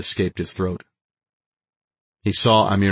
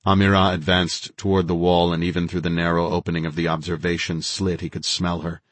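ordinary delivery

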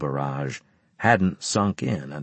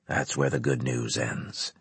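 low, quiet officer voice; brief the officers low and measured; grave commander briefing; 8 semitones lower, 7 dB quieter than usual. A narrator's reading.

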